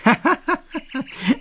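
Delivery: long, high-pitched giggling laugh; teasing laugh. Acted. Making fun of the other's parents, teasing laugh. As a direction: burst into high laughter at your own joke.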